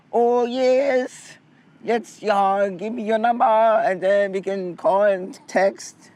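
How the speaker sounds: high, bubbly and eager. Retold or imitated. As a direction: act thrilled to swap numbers. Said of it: "I'm like".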